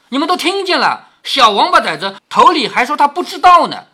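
loud, high accusation to onlookers; indignant scolding. Acted. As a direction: denounce a lying servant before everyone. 凤姐当众斥骂仆人. (气愤地高声斥骂)

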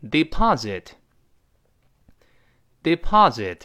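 "deposit" said slowly, first British po then American pa; slow "deposit", "deposit", changing the o to ah the second time; model pronunciation. Shown as a demonstration. Teacher models the two pronunciations of deposit slowly. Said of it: "我们读一下deposit".

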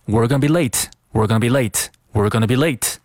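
"We're gonna be late" run together quickly; fast linked reading. Shown as a demonstration. Teacher drills natural speed, quick linked "We're gonna be late". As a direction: rattle off "We're gonna be late" as one unit. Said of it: "如果你语速比较快的话呢".

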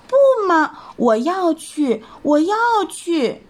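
stamp and whine like a spoilt bunny; high whining bunny refusing to stay home; whiny little rabbit. Acted.

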